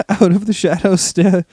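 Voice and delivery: narrating through giggles; can't keep a straight face; laughing. Real, not acted. Narrator cracking up reading.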